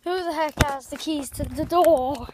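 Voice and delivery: loud, slow call through the house. Real, not acted. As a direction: call out to everyone at home. Arriving home calling out.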